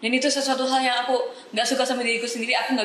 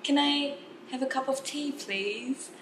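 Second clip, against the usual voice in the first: slow, higher-pitched British customer's order; British accent; 5 semitones higher, much slower than usual. Shown as a demonstration. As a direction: order politely in British English, drawn out. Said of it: "bahasa di U K". (logat British, pelan dan mendayu)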